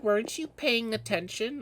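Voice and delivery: shrill-ish mock reprimand, way up; imitating scolding teacher. Teacher scolding the daydreaming kid, squeaky high mock tone. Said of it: "She's like".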